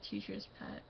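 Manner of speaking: whispered aside. Acted. Repeating teacher's pet label.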